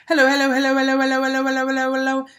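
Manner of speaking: fast, high, loud rattle; rapid taunting. A narrator's reading. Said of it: "as fast as she could".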